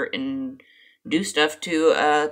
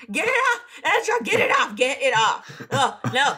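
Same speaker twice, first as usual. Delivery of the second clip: high frantic squeal, laughing; panicked shriek; 8 semitones higher than usual. Acted. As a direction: shriek to get it off you. Frankie skin on her hair.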